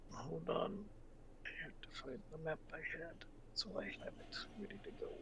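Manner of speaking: muttered whisper aside. Real, not acted. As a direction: whisper while hunting for notes. GM whispers aside while searching.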